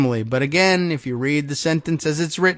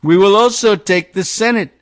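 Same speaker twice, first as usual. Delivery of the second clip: loud, higher mock proclamation; all-caps shout; 6 semitones higher, 6 dB louder than usual. Host reading Trump's post, loud mock rally shout. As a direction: bellow it like a campaign rally. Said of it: "all caps".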